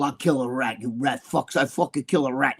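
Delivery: lightly voiced mobster, low and soft; quiet, low mock threat; Sammy the Bull. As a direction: lightly imitate a menacing mobster. Mimics Sammy the Bull's threat.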